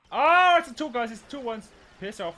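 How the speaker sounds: high, very loud cry of alarm; startled yell. Real, not acted. Gamer jumped by two enemies, real startled yell. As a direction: yelp in shock, then shout them off.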